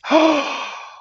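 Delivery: big audible gasp for effect; deep breath. Shown as a demonstration. Showing what Sunday is, with a deep breath. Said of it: "The deep breath".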